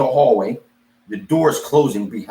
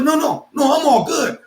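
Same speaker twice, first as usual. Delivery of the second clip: high-pitched insistent brush-off; mock-breezy refusal; 14 semitones higher than usual. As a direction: turn the offer down, pitched way up. Acted refusal with attitude.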